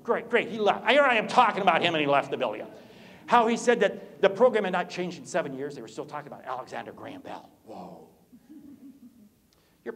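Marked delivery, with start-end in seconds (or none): 0.0-5.8 loud indignant shout; mock outrage. Real, not acted. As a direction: holler after someone who walked out. Calls after Alex who left room.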